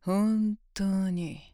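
usual speaking voice

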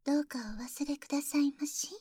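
soft whispered plea; whispering. Acted. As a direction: breathe it into the ear. (ささやき声)

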